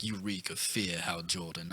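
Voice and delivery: low, quiet, menacing villain voice; Sinestro menace. Retold or imitated. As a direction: growl it softly like a cold villain. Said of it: "'Cause he really, like".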